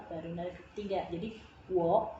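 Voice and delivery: usual speaking voice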